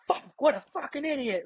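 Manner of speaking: high, exasperated curse at himself; angry self-scolding. Retold or imitated. After smashing into a pillar, cursing himself angrily. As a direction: snap at yourself for being clumsy. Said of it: "calling myself an idiot".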